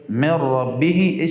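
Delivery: من ربه chanted slowly, nun merged into ra; recitation demo. Shown as a demonstration. Teacher recites من ربه to show merging. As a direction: chant من ربه with no nasal hum. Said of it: "اصلش من ربه است".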